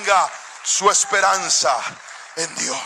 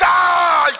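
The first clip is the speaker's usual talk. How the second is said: high shouted yelp; mock scream; 9 semitones higher than usual. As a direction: cry out as if falling. Another scream.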